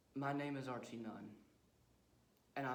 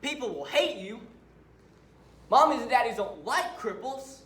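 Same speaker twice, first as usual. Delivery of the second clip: high, loud tirade; raised-voice angry rant; bitter shouting; 10 semitones higher, 17 dB louder than usual. Acted. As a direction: drive each cruel truth home at full volume. Killer rants bitterly at listener.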